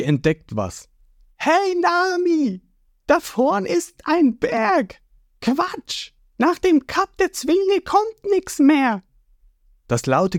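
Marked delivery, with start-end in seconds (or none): 1.4-9.0 high, slow, squeaky character voice; cartoon crew voice. Sanji spotting a mountain ahead, high cartoon call.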